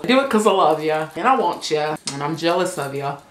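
ordinary delivery

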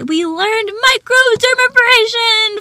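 loud, high, drawn-out cheer; excited announcement. Real, not acted. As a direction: proudly belt out the big news. Excited announcement of class lesson.

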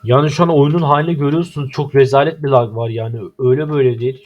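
telling the audience how bad it is; loud complaint. Real, not acted. Showing viewers the terrible lag. (yüksek sesle şikayet)

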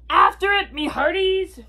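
high, loud captain's order; pirate command. A narrator's reading. Braidbeard sends crew after the ball, loud command. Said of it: "Braidbeard commanded".

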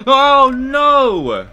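very high, loud drawn-out cry; dismayed wail. Real, not acted. Gamer sees their goose killed, loud dismayed wail.

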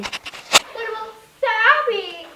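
high protest. Real, not acted. Friend protests for other dog.

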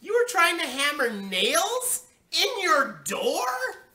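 slow, high, loud outraged question; mother's yell. A narrator's reading. Mother confronts daughter about nails, yelling slowly. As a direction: drag out each phrase in a disbelieving yell. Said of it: "she yelled".

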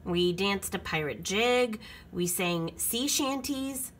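plain reading voice

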